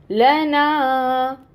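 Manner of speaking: लना very slow, final ना held long; two-beat madd. Shown as a demonstration. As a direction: draw out ना in लना. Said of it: "इसको पढ़ेंगे लना".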